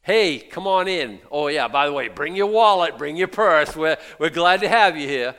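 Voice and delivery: oversell the welcome, then ask for money; pushy church greeter. Retold or imitated. Mocking a church that greets visitors and asks for money, hammed-up. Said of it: "feel like".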